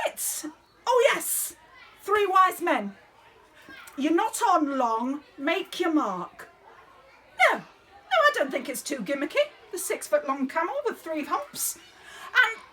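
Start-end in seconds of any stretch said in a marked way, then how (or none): none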